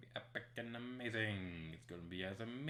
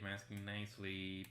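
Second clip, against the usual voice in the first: ordinary delivery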